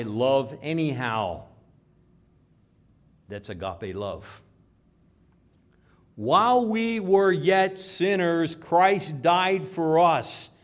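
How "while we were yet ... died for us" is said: loud, high, slow proclamation; declaimed scripture. Acted. Preacher quoting scripture on love for sinners, loud proclamation. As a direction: ring it out word by word, stretched and forceful.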